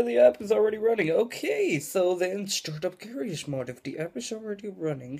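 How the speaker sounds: ordinary delivery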